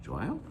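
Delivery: "좋아요" softly, voice rising at the end; question inflection. Shown as a demonstration. Teacher repeating the Korean example, rising question tune. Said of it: "you make it a question".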